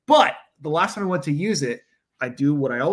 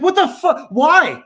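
mock outraged yell; 11 semitones higher, 8 dB louder, slower than usual. Retelling his outburst.

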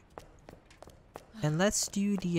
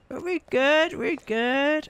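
relieved; 10 semitones higher, 4 dB louder, slower than usual. Real, not acted. Calming after chase.

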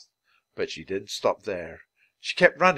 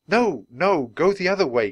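raised, louder urgent protest; alarmed cry; 6 semitones higher, 6 dB louder than usual. A narrator's reading. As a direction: call out to turn the elephant round. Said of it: "cried Jack".

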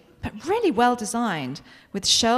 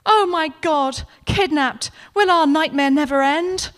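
melodrama; 5 semitones higher, 4 dB louder than usual. Acted. Suze overacting a dramatic line.

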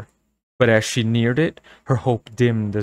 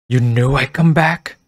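louder, demanding; shrill demand; 3 semitones higher, 6 dB louder than usual. Acted. Girl demanding answers from the store owner, shrill. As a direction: demand it sharply. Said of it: "demanded shrilly".

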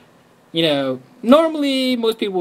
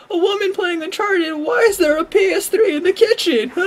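very high, laughing mock jeer; mock the sexist gamers while laughing; 3 dB louder than usual.

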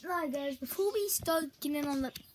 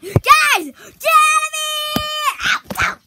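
high, loud, desperate; panicked yelling; 13 semitones higher, 13 dB louder, slower than usual. Acted. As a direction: scream for them to leave.

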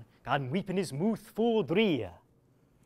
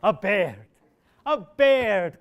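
louder, rising jeer; gleeful crowing; 3 semitones higher, 8 dB louder, much slower than usual. Acted. Nicholas jeering at Absalom, loud and gleeful. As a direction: crow with delight. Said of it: "quoth Nicholas".

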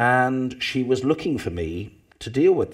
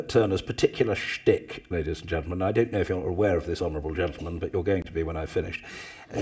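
ordinary talk, nothing marked

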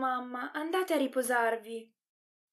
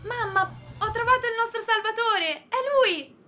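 higher, crying with joy; tearful excitement; 6 semitones higher than usual. Acted. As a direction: blurt it out through happy tears. Girl finds the saviour, overjoyed. (grida commossa alla mamma, piangendo di gioia)

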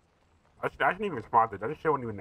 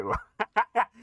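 sudden laugh jumping up high; high burst of laughter; 10 semitones higher than usual. Real, not acted. Laughing at imagined shooting.

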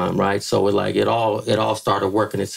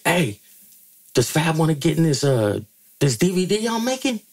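higher, casual offer; imitating B Love; 7 semitones higher than usual. B Love offering Fab a DVD spot, higher casual mimicry. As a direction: lightly voice B Love's invite. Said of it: "B Love's like".

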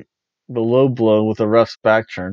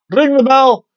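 high, loud shout to start the match; loud call; 13 semitones higher, 7 dB louder than usual. Retold or imitated. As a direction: call it out across the ring. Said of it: "once the referee says".

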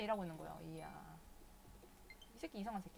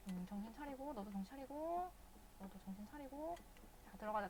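normal talking voice

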